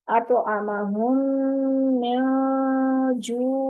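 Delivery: very slow, measured tajweed recitation; 1 slow Quran recitation. Shown as a demonstration. Teacher demonstrates the verse slowly to students. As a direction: draw each word out as a recitation demo. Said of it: "उस पे कलकला भी हो रहा है".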